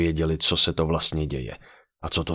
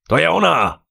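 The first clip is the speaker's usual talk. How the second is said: attacker roars at the top of his voice, higher and louder; roaring shout; 11 semitones higher, 7 dB louder than usual. A narrator's reading. Attacker spotting the pregnant waitress, roaring shout. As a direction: bellow it out like a charging soldier. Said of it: "a zařval".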